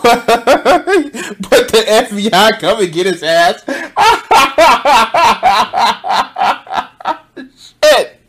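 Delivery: slow words dissolving into a howl of laughter; laughing hard. Real, not acted. Streamer howling at the FBI showing up. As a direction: let the laugh take over.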